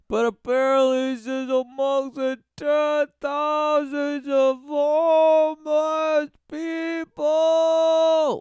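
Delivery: sarcastic indignant build; rising indignant rant. Real, not acted. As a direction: drag out homeless people in outrage. Ranting at news framing.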